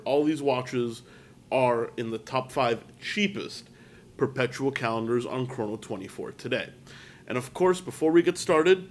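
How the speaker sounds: normal talking voice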